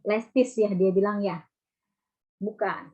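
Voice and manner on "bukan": ordinary delivery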